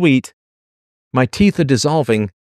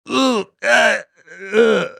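choking groan; 10 semitones higher, much slower than usual. Real, not acted. Recalling winter smoke.

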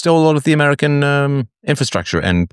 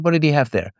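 normal talking voice

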